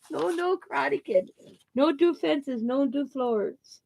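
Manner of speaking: high, giggly doctor mimicry; high-pitched mock prohibition; doctor impression. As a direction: imitate the doctor's list of bans, on the edge of laughter. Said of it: "that's how he said it".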